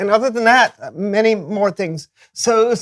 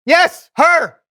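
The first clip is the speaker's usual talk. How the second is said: higher, louder frightened blurt; fearful outburst; 7 semitones higher, 4 dB louder than usual. Acted. Brandon, dreading the clown, blurts out higher and louder.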